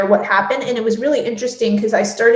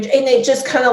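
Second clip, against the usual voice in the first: ordinary talk, nothing marked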